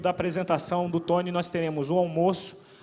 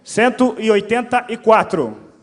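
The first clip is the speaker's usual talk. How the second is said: slow, loud, drawn-out number call; announcer call; 4 semitones higher, 13 dB louder, slower than usual. Real, not acted. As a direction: call the number like a raffle host. Repeating raffle number.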